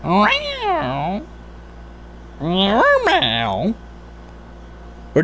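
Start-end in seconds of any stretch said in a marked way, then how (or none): 0.0-3.7 high, drawn-out mock meow; cat meow. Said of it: "do they meow".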